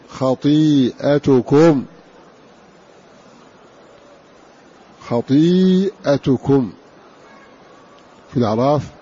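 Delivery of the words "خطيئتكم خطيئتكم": خطيئتكم slow, long "ii", short "a" after hamza, "tu" on ت; singular reading. Shown as a demonstration. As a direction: recite خطيئتكم slowly as "khatii'atukum". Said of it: "مع كسر الطاء ورفع التاء".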